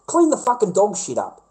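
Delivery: ordinary delivery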